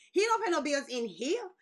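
normal talking voice

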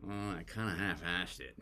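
low, soft mock regret; understated acted disappointment; regretful admission. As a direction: admit it quietly, as if to yourself. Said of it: "failing and going".

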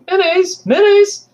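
put-on very high child's voice calling out; young boy searching for his pet; boy calling his cat. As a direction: call the cat like a worried little boy. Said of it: "So you hear this boy go".

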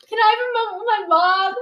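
high, loud mock-tearful plea; bawling retold. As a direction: plead as if bawling, hammed up. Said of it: "So now I'm bawling in his office".

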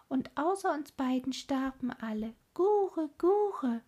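high cooing bird voice, mournful; cooing wood doves. Acted. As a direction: coo it like a wood dove telling sad news. Wood doves tell Gerda.

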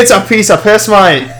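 hammed-up Australian accent. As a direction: say it as a breezy Australian. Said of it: "That was Australian".

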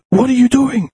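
imitated recruiter. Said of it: "he goes".

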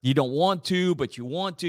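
ordinary talk, nothing marked

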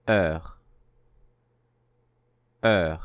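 Heure said with no H and a rounded vowel; model word. Shown as a demonstration. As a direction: say Heure slowly as a model for repeating. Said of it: "Encore une fois".

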